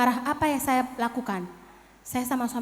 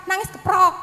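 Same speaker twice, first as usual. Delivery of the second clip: retold harsh punishment; 6 semitones higher, 6 dB louder than usual. Soldier father punishing a crying child.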